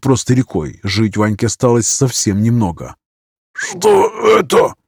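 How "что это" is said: high, slow, strained rasp; hoarse rasp. Acted. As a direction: rasp it out like a dying man. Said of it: "прохрипел Иван".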